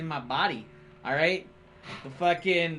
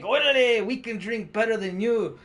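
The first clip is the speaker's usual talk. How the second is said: high, loud Chicano bravado; Chicano accent; 5 semitones higher, 4 dB louder than usual. Retold or imitated. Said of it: "The fucking Chicano ... is like".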